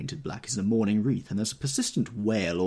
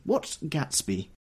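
puzzled; 7 semitones higher, much slower than usual. Acted. Daisy puzzled.

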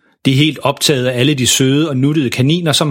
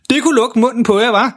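triumferende, højere leje; stolt far; 10 semitones higher than usual. Acted. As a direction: pral lidt over for ungerne. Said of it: "siger far stolt". (stolt og triumferende)

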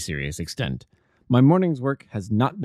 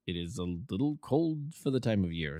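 calm Holmes; 10 dB quieter than usual. A narrator's reading. Holmes ignoring Roylott, calm and soft. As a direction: brush him off with calm ease. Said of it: "Holmes ignores him calmly".

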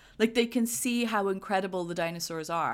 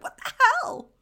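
very high, loud squawk of disbelief; mock astonishment; 9 dB louder than usual. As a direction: imitate a stunned outburst way up high. Voices scientist's astonished reaction.

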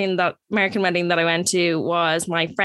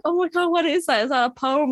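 much higher, gushing mock amazement; awed American guests; 8 semitones higher than usual. Americans hearing her fake Irish poem, gushing mock awe. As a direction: imitate impressed wedding guests, starry-eyed.

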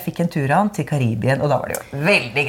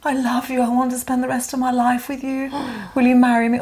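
imitating tearful proposal; 7 semitones higher than usual. Storyteller imitating her boyfriend kneeling in tears, tearful proposal. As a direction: plead in a high voice, as if crying. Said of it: "gråter og sier".

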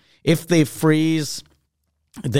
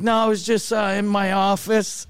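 higher-pitched, hesitant cover story; mock-innocent excuse, pitched up; sheepish fib; 5 semitones higher than usual. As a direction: pretend you were awake all along. Faking being awake on phone.

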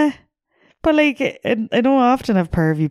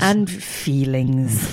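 mock solemn; 6 semitones lower, 3 dB quieter, much slower than usual. Mock earnest aside.

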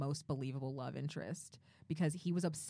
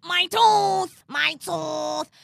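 much higher and louder, wailing; wailing poodle; 12 semitones higher, 14 dB louder, much slower than usual. Retold or imitated. Quoting the poodle's tooth wail, high and loud. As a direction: cry out like a vain poodle losing a tooth.

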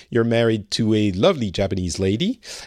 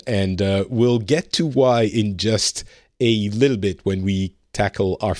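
usual speaking voice

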